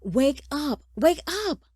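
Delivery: high, loud, insistent call; urgent wake-up shout. A narrator's reading. Son shaking his father awake, urgent high shout.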